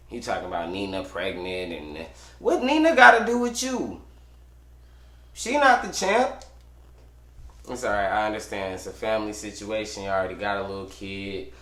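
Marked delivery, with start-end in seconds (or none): 2.4-6.4 heated. Real, not acted. Ranting at retired champ.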